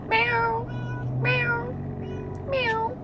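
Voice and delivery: squeaky imitated meowing; high mock meows. Real, not acted. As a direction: meow back at the cat, high. Meowing back at the cat.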